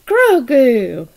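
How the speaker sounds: loud, drawn-out reprimand of the name; mock scolding. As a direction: call out a naughty puppet, scandalized. Scolding the doll.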